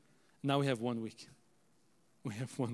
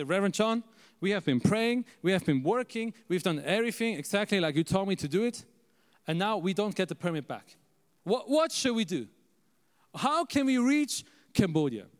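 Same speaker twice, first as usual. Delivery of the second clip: higher, insistent retold appeal; urgent plea; 5 semitones higher, 3 dB louder than usual. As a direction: plead your case to a mentor, building to the last question. Retold plea to his mentor.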